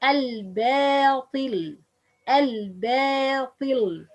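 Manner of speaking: "Al-batil" with a light, thin ba, long and drawn out; slow "Al-batil", keep the ba light before the heavy letter; correct light ba. Shown as a demonstration. Teacher models the right pronunciation, light ba. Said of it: "It's the right pronunciation".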